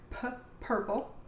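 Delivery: "p" popped alone, then "purple"; first sound split off. Shown as a demonstration. Teacher showing her marker, first sound split off. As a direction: isolate the p before "purple". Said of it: "They all make the p sound".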